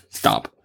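buzzing cicada noise. Shown as a demonstration. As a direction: do a cicada buzz. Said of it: "That's my cicada impression".

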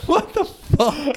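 a high laughing what the fuck; laughing disbelief. Real, not acted. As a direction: crack up while asking. Laughing at the Jesus twist.

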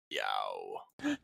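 deep, human-sounding cat yowl; human meow. Retold or imitated. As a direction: yowl like a person pretending to be a cat. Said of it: "meow in the most human voice".

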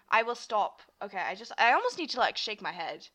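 normal talking voice

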